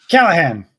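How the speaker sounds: amateur impression of a stern superior; movie authority figure. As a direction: act out Callahan's superior giving orders. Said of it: "or impression".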